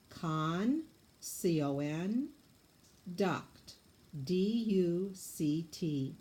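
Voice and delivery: "Con" then C O N, "duct" then D U C T, letters spaced out; syllable-by-syllable spelling demo; scooping syllables. Shown as a demonstration. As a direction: say each syllable of "Conduct", then spell it slowly. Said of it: "Now scoop it for me".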